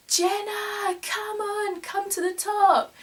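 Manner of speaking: high, drawn-out call from afar. Retold or imitated. Two companions calling her up to the hilltop, calling out high. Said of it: "started calling out to me going".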